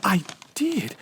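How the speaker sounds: breathy hushed I did; whispered. Acted. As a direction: whisper it eagerly. Amelia whispering.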